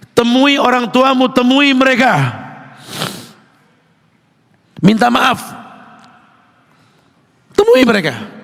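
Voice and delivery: loud fervent sermon; preaching at full force. Acted. Preacher pressing the congregation about parents, loud and fervent.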